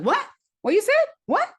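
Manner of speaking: high, loud imitation of an irate father; father's sharp demand. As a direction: fire off loud rising questions as the father. Father's angry reaction, acted in story.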